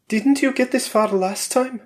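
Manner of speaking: a wary wife pressing her husband; Valeria's voice. A narrator's reading.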